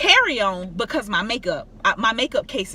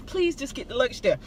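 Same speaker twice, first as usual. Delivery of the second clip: much softer pleading prayer; hushed prayer; 6 dB quieter than usual. Real, not acted. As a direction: murmur it to the Lord. Private prayer for luggage.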